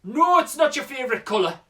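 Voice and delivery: put-on loud, high Geordie boy; imitated Newcastle lad, high and indignant; Newcastle accent. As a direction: protest loudly in a Geordie accent. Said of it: "That's my best Newcastle accent".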